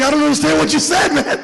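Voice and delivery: laughing confusion. Retold or imitated. Comedian baffled.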